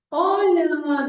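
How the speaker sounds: bright high loud "Hola"; exaggerated hello to a small child; animated greeting. Shown as a demonstration. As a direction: greet a toddler with exaggerated delight. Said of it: "El que yo pueda decir".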